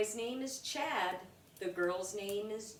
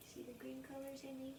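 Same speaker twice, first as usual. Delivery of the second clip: soft aside; 4 semitones higher, 14 dB quieter, much faster than usual. Real, not acted. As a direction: quietly show Amy the page. Aside to child Amy.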